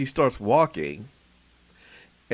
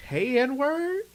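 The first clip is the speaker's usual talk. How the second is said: high mock greeting; 12 semitones higher than usual. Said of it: "just goes".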